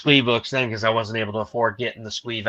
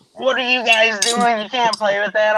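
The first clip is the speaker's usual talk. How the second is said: alarmed parent scolding; 9 semitones higher, 6 dB louder than usual. Retold or imitated. Dad catches kids playing with suppositories, alarmed scolding. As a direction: retell a parent's loud alarm.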